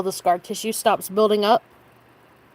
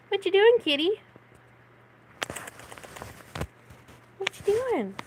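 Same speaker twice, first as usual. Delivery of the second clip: pet talk; 9 semitones higher, 3 dB quieter than usual. Real, not acted. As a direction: coo up high to coax the kitty. Talking to the pet cat.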